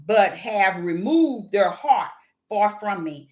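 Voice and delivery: slow, deliberate Bible reading; scripture reading. Acted. Reading Isaiah to her viewers, slow solemn scripture read. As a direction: read the verse slowly and solemnly.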